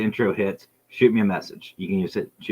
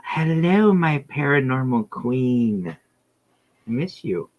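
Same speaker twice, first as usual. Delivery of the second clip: high, louder, slow fond greeting; gushing hello to a dear friend, pitched up and drawn out; Patty's voice; 6 semitones higher, 5 dB louder, much slower than usual. Retold or imitated. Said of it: "and she says".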